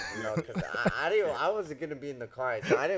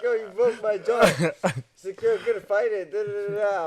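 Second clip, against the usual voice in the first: higher, louder, mock-excited quote; 8 semitones higher, 7 dB louder than usual. Boxer imitating the grinning opponent praising him, higher and louder. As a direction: ham up the opponent's glee. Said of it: "He's, like, all excited".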